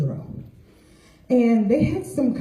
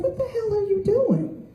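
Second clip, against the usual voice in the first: surprised; 12 semitones higher, 3 dB quieter than usual. Retold or imitated. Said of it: "he said".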